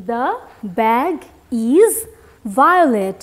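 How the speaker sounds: very slow, every word stretched; slow model reading. Shown as a demonstration. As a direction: read it slowly for young pupils.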